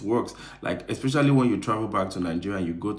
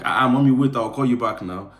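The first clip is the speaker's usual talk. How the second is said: put-on loud, higher-pitched switch into Nigerian accent; animated Nigerian family-call accent, raised voice; home accent for mom; 5 semitones higher, 8 dB louder than usual. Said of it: "automatically my accent just changes".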